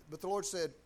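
normal talking voice

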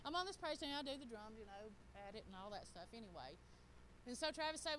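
ordinary talk, nothing marked

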